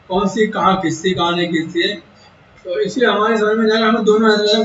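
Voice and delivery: ordinary talk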